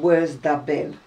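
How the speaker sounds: "Where's tha been?" in broad Oldham dialect, tha short and unstressed. Shown as a demonstration. Showing her friend the old Oldham way of speaking, broad Lancashire. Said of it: "In other words, "Where have you been?".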